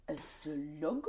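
put-on low, soft, puzzled French accent. French girl trying the new English word, French accent. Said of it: "And the French girl, she would say".

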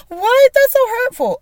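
high, loud mock-wounded squeal; mock hurt shock. Mocking her ex, voicing her hurt in a high squeal. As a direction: play the offended victim, hammed up. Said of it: "And I'd be like".

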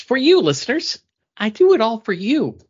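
high, loud, hammed-up devotion; mock grand dedication to the listeners; mock heartfelt dedication. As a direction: play it up like a grand sacrifice for the fans.

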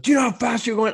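high, loud imitation of an angry cop; mad cop. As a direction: demand it like a retold furious officer. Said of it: "came up to the car so mad".

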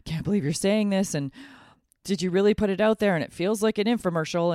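a scandalized inner critic who can't believe what you just posted; incredulous inner voice. Retold or imitated. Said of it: "Part of me right now is resisting this, going".

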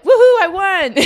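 bright laughing woohoo; excited cheer. Pretend. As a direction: cheer it like a winner. Imagined dog owner celebrating.

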